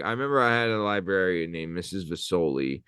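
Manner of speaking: usual speaking voice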